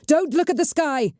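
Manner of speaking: high urgent yell. A narrator's reading. Cheta warning the witch off the sky, high urgent yell. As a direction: stop her looking up. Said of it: "Cheta yelled".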